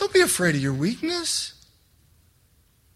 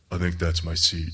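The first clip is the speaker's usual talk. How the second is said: much lower, mock macho rumble; manliest deepest voice; 13 semitones lower, 4 dB quieter than usual. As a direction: put on the biggest, deepest tough-guy voice. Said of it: "in the manliest, deepest voice I've ever heard".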